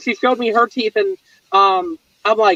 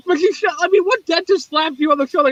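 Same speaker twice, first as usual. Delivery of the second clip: usual speaking voice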